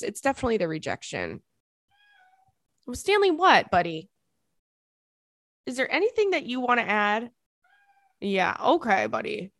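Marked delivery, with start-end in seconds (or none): 2.9-9.5 high sing-song pet voice; talking to the dog. Real, not acted. To Stanley the dog, high sing-song coaxing. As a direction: coax the dog to join in.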